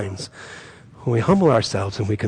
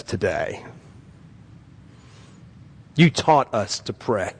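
earnest pastoral prayer; congregational prayer. Real, not acted. Pastor praying over the congregation, earnest prayer. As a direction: lead the church to God reverently.

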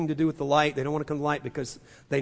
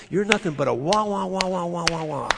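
mock gong wah. Mimics noisy gong sound.

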